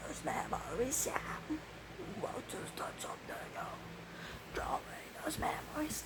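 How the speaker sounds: breathy whisper, no voice; whispered lyric. Acted. Whispering a song line to the listener. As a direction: whisper it like a secret.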